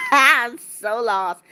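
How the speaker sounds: slow words coming out of a laugh; amused, drawn-out bewilderment; amused confusion. Real, not acted. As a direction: say it slowly, recovering from real laughter.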